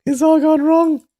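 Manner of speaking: loud lament climbing to a high wail; dismayed shouting. A narrator's reading. Young man sees the plan ruined, shouting in dismay. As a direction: cry out that disaster has struck. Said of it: "These shouts surprised Mei Chaofeng".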